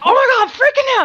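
high, loud, fast imitated panic; hammed-up panic, rushed and pitched high; mock freak-out. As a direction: imitate kids losing it. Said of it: "And they're like".

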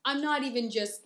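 normal talking voice

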